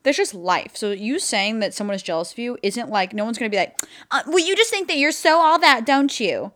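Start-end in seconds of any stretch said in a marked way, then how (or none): 4.1-6.5 mock snide accusation. Imagined accusing person.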